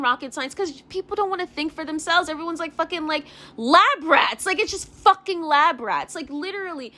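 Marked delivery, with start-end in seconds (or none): none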